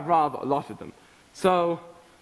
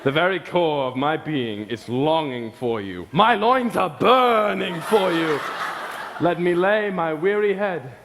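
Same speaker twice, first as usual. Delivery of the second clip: much lower, hammed-up romantic declaration through laughter; mock seductive lover; 6 semitones lower than usual. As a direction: play a swooning lover, half laughing. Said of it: "you stare her straight in the eye and you say".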